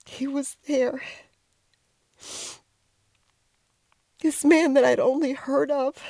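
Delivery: high, tearful and choked up; fighting back tears at the grave; voice breaking with emotion. Real, not acted. Finding grandfather's grave, overwhelmed.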